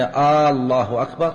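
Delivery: الله begun on a long, slow "aa"; lengthened first hamza. Shown as a demonstration. Teacher explaining the changed meaning, opening vowel of الله stretched. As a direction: draw out the آ opening of الله like a question. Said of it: "إذا مد الهمزة".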